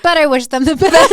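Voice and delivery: high, loud sugary sign-off; mock polite damage control. Smoothing over an opinion with a sugary high wish. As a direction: sweeten it up to dial it back. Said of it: "be like".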